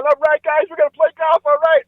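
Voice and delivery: very high, giddy cheer; jumping-up-and-down excitement. Acted. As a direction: whoop it up like golfers off on holiday. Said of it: "they're jumping up and down".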